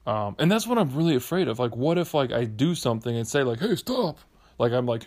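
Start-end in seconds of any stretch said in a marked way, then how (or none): 3.6-4.2 high-pitched mock warning; pretend scolding. Man imagines confronting neighborhood boys, high mock scolding. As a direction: rehearse telling off some kids.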